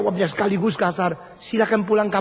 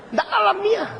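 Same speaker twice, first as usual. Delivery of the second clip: high exclaimed unnatural; 13 semitones higher than usual. Acted. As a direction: jump up high on "Nggak alamiah". (seruan tinggi mengejek)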